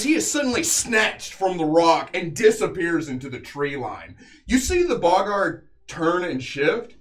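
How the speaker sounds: dramatic battle narration, leaning on the big action words; game master narration. A narrator's reading. Game master telling players of the beast's fall, dramatic narration. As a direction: tell the killing blows like an epic tale.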